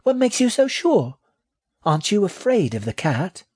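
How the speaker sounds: very high little rat voice; high-pitched young creature; doubting baby rat. A narrator's reading. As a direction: question as a tiny, doubtful rat.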